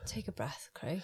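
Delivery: soft whispered aside. Real, not acted. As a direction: whisper it close. Whispered aside to panting friend.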